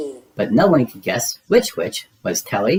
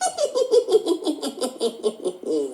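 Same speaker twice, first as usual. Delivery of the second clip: high witchy cackle; high cackling witch laugh; 5 dB quieter than usual. Acted.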